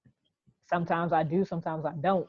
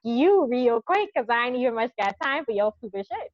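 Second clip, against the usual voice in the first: loud, high, cussing someone out; sassy Geechee tell-off; 7 semitones higher, 7 dB louder than usual. Acted. Showing the language she can still come out with, mock tell-off. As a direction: tell them off with big Charleston attitude. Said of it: "I still will come out with this language".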